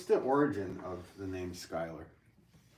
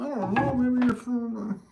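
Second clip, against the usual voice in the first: much higher, louder, slowed-down clueless voice; mocking himself; 7 dB louder than usual. Streamer retelling his own clueless guess to chat, high mock voice. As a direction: play yourself as a dopey guesser, high and drawn out. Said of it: "and I'm going".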